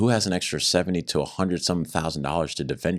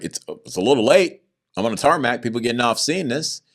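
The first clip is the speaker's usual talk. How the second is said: indignant protest; 7 semitones higher, 4 dB louder than usual. Retold or imitated. Protesting arrest on the tarmac.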